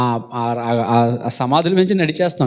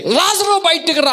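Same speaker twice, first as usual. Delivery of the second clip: high-pitched retold summons; imitated commanding call, pitched way up; loud calling; 11 semitones higher than usual. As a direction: call him out, high and quick. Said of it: "బిగ్గరగా పిలిచాడు అట".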